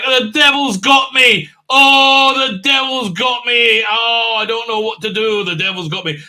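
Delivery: high, loud, slow mock wailing; hammed-up despair. Witchfinder woman fearing the devil, hammed-up slow wail. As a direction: overplay the terror of possession.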